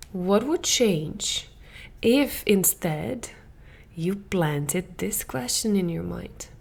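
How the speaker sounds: a calm coach inviting reflection; slow gentle question. Shown as a demonstration.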